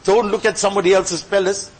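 ordinary delivery

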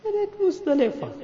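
very high, sobbing voice; tearful cry. Real, not acted. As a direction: break suddenly into high weeping. Overcome again while praying.

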